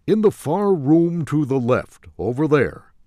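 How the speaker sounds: voiced salesman; salesman directing. A narrator's reading. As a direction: point across the room as the clerk. Salesman points to distant corner.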